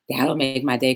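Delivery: ordinary delivery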